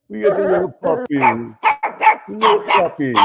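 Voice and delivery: high-pitched cooing over a puppy; puppy talk. Pretend. Host fusses over a little puppy, high puppy talk.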